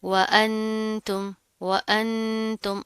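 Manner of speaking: व अंतुम slowly, n hummed before t; held nasal n. Shown as a demonstration. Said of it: "हरूफ इख्वा में से ता". (अंतुम में नून का इख्फा)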